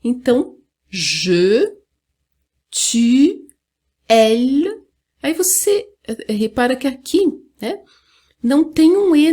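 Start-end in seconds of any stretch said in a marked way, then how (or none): 0.9-4.7 "je, tu, elle" one by one, carefully separated; French pronoun model. Shown as a demonstration. Teacher modelling French pronouns for students, word by word. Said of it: "Aí fica certinho".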